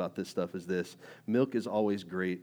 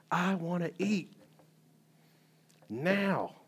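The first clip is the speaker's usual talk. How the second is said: put-on higher, slow whiny baby voice; whiny hungry baby; 8 semitones higher, much slower than usual. Preacher imitating a hungry baby demanding food, whiny. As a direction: whine it like a hungry infant. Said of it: "a hungry baby cries, gets mad".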